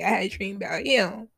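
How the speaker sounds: ordinary talk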